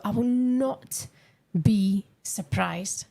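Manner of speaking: "I will not be surprised" slowed right down, word by word; emphatic. Real, not acted. Commentator insisting on a transfer prediction, slow emphatic delivery. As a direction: spell out "not be surprised" slowly, each word weighted.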